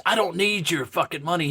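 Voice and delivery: usual speaking voice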